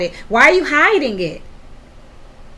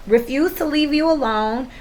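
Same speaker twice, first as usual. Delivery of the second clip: ordinary delivery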